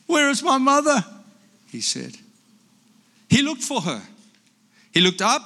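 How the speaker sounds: lively picture-book reading with character voices; reading to children. Acted. Grandpa reading a picture book aloud to grandchildren, lively. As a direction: read it like a bedtime story to grandkids.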